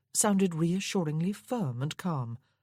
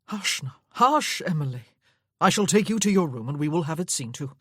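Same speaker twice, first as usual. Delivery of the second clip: urgent soothing; 6 dB louder than usual. Acted. Man soothing panicked hurt woman.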